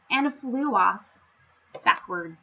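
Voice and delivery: plain reading voice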